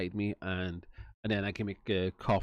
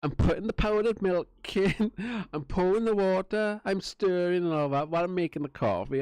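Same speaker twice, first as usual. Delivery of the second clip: higher, cheeky play-by-play of making coffee; teasing the police through the door, higher cheeky voice; cheeky narration; 9 semitones higher than usual. Retold or imitated. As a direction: taunt them by narrating each step. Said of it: "I said".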